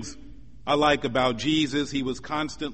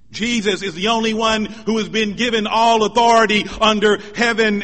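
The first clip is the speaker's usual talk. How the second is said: loud, raised, insistent sermon voice; forceful preaching; 7 semitones higher, 8 dB louder than usual. Real, not acted. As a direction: drive each declaration home to the congregation. Preacher proclaims at full force.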